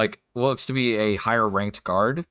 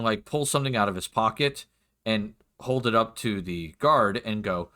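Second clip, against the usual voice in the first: normal talking voice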